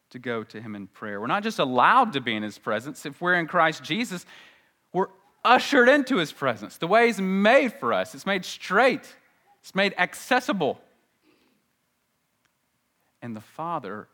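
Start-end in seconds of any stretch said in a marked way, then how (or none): 1.2-10.8 higher, insistent pulpit voice; fervent preaching. Real, not acted. Preacher tells believers they're ushered in, fervent preaching. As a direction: press each phrase with growing force.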